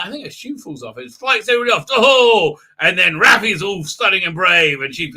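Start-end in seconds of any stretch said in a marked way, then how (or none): none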